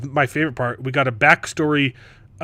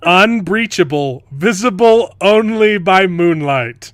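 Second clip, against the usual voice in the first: loud, high, slow mock-epic voice; grand proclamation; 6 semitones higher, 9 dB louder, slower than usual. Mocking the door's supposed invisibility, booming proclamation. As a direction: boom it out like a grand declaration.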